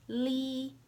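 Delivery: "Li" held high and level; first tone. Shown as a demonstration. Teacher showing the tones, "Li" high and level. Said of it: "I'll say the four tones".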